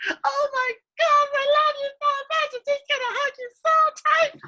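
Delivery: hammed-up, very high squeal of love; delighted mommy. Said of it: "She'll be like".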